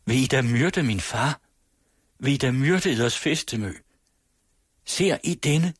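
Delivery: as if bewildered, crying out with restraint; mildly distraught cry. A narrator's reading. Daughter pleading for her father's life, mildly distraught cry. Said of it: "råbte ligesom forvildet".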